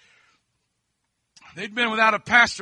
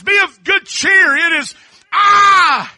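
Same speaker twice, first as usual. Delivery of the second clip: higher, louder, slow commanding call; Jesus calling out; 7 semitones higher, 8 dB louder than usual. Acted. Jesus calling to frightened disciples, slow and loud. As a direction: reassure the boat over the wind.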